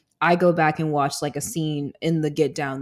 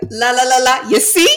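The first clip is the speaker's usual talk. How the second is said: high, loud mock Italian singer; hammed-up Italian movie accent; 6 semitones higher, 8 dB louder than usual. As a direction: put on a playful Italian accent, half sung. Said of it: "I can do an Italian accent".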